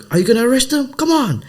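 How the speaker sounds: indignant high appeal to a policeman; retold exasperated plea; high-pitched protest. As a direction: plead for action, voice way up. Retells appeal to indifferent policeman.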